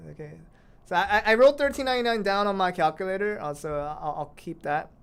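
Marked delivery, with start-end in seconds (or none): none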